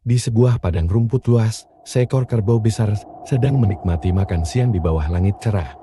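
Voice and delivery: ordinary delivery, nothing marked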